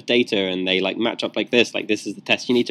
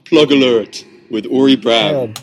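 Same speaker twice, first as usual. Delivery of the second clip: announcer callout; 6 dB louder than usual. Acted. Joins the jingle.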